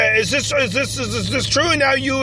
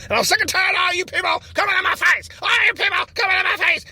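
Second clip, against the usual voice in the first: higher, shouted rant; angry rant; 8 semitones higher than usual. Acted. Candidate blowing up at reporters crowding him, ranting angrily. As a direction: blow up at the reporters. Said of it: "calm down, buddy, calm down".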